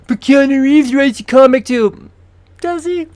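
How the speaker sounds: high-pitched heckler, loud then questioning; put-on high objecting voice; mock objector. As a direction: butt in with a squeaky mock protest. Mimics an imagined objector.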